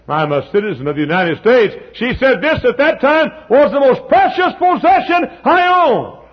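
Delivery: retold testimony rising to a high shout; preacher crying out a quote; full-force preaching. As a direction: build to a loud pulpit climax.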